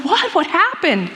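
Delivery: sharp surprised rise on What, then settles; John caught off guard by the news; shocked question. Retold or imitated. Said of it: "John's like".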